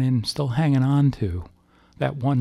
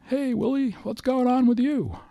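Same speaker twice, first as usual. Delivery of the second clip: much higher voice, a breezy hello; Ed's cheery greeting. Retold or imitated. Ed on the phone greets Willie in a much higher voice. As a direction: pitch way up to play a friend calling. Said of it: "it was Ed".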